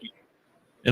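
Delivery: normal talking voice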